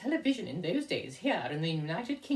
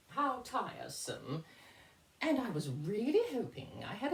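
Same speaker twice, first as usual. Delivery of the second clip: lightly voiced theatrical grande dame; a touch of camp lady host; drag lady persona; 8 semitones higher than usual. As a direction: play the fussy diva lightly.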